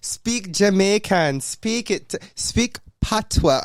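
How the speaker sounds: high, drawn-out demanding mimicry; mock demanding friends. Host imitating friends demanding patois, high slow nagging. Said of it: "Every time I talk about".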